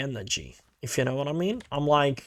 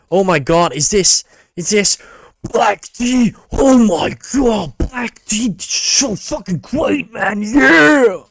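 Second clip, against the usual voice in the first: much higher, louder bro hype ending in a shout; hammed-up slow exclaimed gushing; mock overreacting dude; 6 semitones higher, 9 dB louder, slower than usual. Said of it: "they all like that".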